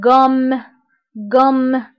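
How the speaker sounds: غوم with the م pressed and held; stressed double m. Shown as a demonstration. Teacher showing the doubled consonant, pressing the م. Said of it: "كنضغط على هاد الام".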